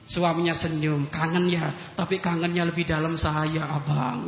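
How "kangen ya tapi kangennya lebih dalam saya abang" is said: tender reply. Retold or imitated. Husband answers tenderly.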